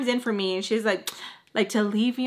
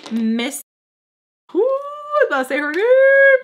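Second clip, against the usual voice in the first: excited; 9 dB louder than usual. Real, not acted. Dramatic pause, building suspense.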